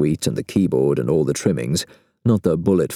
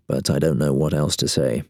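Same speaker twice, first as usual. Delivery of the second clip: ordinary delivery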